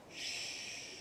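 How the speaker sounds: unvoiced hush; soft shush; whispered shush. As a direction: hush the listener.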